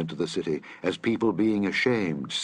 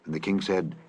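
usual speaking voice

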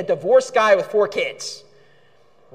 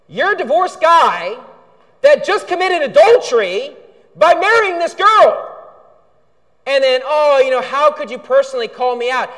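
louder and higher, hammering rebuke; fiery preaching; 7 semitones higher, 6 dB louder than usual. Acted. Pastor rebukes the con man from the pulpit, loud and indignant.

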